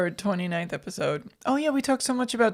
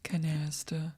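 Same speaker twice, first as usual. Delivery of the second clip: whispering; 4 semitones lower, 9 dB quieter, slower than usual. Real, not acted. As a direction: whisper "Canasta" low. Whispered word.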